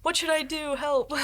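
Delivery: high, pleading little cry for help; mock helpless plea. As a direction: play it like a lost kid begging for help. Mock plea to older mentors.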